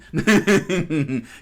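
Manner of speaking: high, loud burst of chuckling; loud real giggle. Real, not acted. Host laughs at his terrible accent, loud giggle. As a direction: giggle loudly at yourself.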